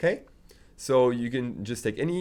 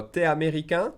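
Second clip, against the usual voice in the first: contracted "T'es américain", higher and rising at the end; question by intonation; 5 semitones higher than usual. Shown as a demonstration. Teacher offers the short question, "américain" rising. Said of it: "make it sound like a question".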